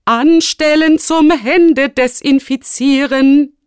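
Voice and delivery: high, loud, slow command; barked order. A narrator's reading. Rector sending pupils to disinfect hands, loudly commanding. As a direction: call the whole school into line.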